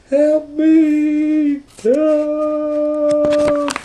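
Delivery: very high thin faraway cry; distant cry for help. Acted. Rosie crying for help from the top of the inn. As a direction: call out small and far off.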